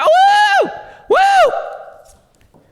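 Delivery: high shouted woo woo; excited whoop. Retold or imitated. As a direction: whoop like he just found out he won. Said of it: "He's like".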